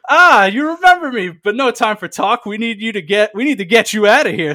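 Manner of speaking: high, loud excited elder voice; hurried, eager tree folk; Dungrove Elder voice. Acted. Dungrove Elder speaks urgently.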